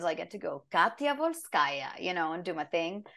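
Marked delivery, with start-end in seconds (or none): 0.7-1.9 slow, accented in-character delivery; Sombra. Shown as a demonstration. Actor showing how she does her lines, slow character voice. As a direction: draw the name out teasingly. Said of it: "when I'm doing my lines".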